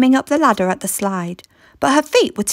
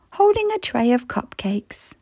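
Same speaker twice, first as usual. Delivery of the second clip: usual speaking voice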